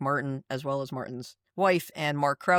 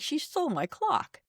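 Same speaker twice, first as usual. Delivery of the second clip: much higher, hurt imitation of Martin; upset; 11 semitones higher, 3 dB quieter than usual. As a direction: squeak it out like a wounded child. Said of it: "he was ups".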